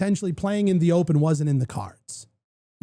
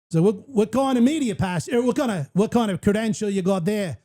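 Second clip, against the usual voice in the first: higher-pitched Irish accent attempt drifting Australian; botched Irish, sounds Australian; 4 semitones higher than usual. Podcaster imitating a guy checking his media pass, botched Irish-turned-Australian accent. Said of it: "No, that's a little Australian. I'm missing it".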